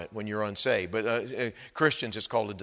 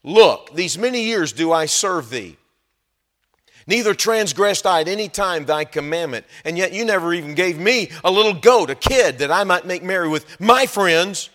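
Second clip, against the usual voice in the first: a hint of an angry son, louder throughout; rude complaint; 5 semitones higher, 10 dB louder than usual. Older brother gripes loudly at his father. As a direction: gripe it at your dad. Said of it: "rude way to talk to your dad".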